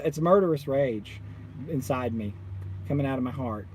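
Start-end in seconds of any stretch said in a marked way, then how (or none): none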